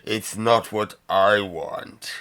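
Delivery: very slow, drawn-out villain verdict, swelling at the end; gloating crime lord. Acted. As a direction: stretch each word, building to the last. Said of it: "he quickly looks at her and says".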